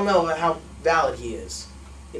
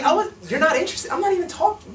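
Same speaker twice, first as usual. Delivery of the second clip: high, laughing mock protest; feigned surprise; 9 semitones higher than usual. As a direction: imitate baffled innocence, pitched way up. Replays feigned surprise at rejection.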